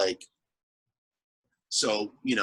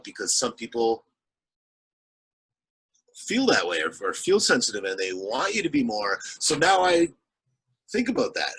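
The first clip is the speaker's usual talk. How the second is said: ordinary delivery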